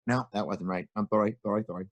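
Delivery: ordinary delivery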